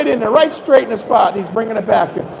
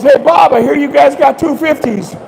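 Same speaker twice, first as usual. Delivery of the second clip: louder, cheerful call across the room; loud announcement; 4 semitones higher, 4 dB louder than usual. Retold or imitated. Camp owner's son calls out the big catch to the whole dining room, loud. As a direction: broadcast the news to the whole restaurant. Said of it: "Randy walks up to the table and goes".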